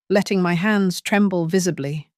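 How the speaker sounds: ordinary delivery, nothing marked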